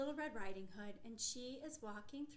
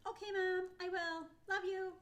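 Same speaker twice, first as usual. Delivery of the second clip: put-on little girl voice; 7 semitones higher, 8 dB louder than usual. Child voice answering her mother.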